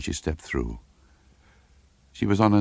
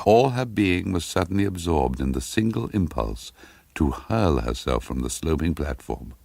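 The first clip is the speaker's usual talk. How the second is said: usual speaking voice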